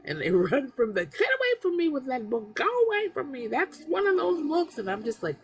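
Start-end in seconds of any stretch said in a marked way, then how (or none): 1.0-4.8 high, loud get-away shriek; much higher, shooing it off loudly; panicked friend. Retold or imitated. Mocking friends who flee the book.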